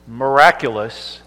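ordinary talk, nothing marked

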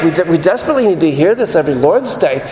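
usual speaking voice

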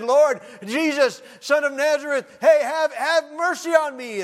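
high, loud, slow desperate plea; crying out. Retold or imitated. Blind man crying to Jesus, high and loud. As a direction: cry it out like a blind man begging. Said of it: "He was crying out and said".

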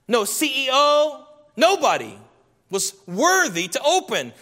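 a climbing sermon roll call; rising preaching list. Acted. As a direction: build the list like a preacher working up the room. Preaching list at full force.

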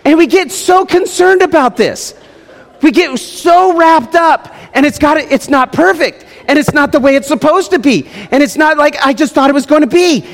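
climbing pulpit intensity; full-force preaching. Acted. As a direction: drive the point home from the pulpit.

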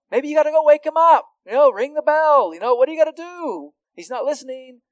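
high, loud, fast mocking taunt; mocking prophet. Isaiah taunting Baal's followers, mocking and loud. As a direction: jeer at the false god's followers, sky-high and loud. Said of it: "Isaiah's like".

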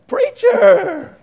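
high, loud, drawn-out cry; comic shout of alarmed recognition; startled holler. Retold or imitated. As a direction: blurt it out as if caught. Startled man with beer greets preacher.

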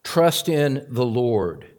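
slow, deliberate verse reading; slow scripture reading. Shown as a demonstration. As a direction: give each word room. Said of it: "I'll read it slowly".

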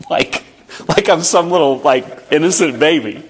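laughing through a self-mocking aside. Real, not acted. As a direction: chuckle at being called innocent. Laughing at being treated as baby.

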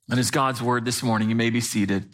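normal talking voice

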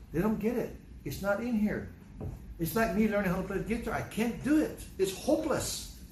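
high, exasperated complaint. Retold or imitated. Grandfather venting about the grandkids, exasperated and high. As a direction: throw up your hands, voice climbing. Retold frustration with kids.

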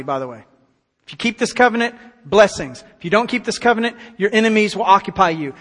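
ordinary talk, nothing marked

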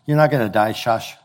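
soft, low no-fuss grandpa; grandfather hushing. Retold or imitated. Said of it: "heard this from her grandfather".

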